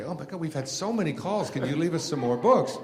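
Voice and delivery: high, loud mock gushing, building; breathlessly eager plea, played up; excited bookseller. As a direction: imitate a thrilled shopkeeper begging for stock. Acts out swamped bookstore staff.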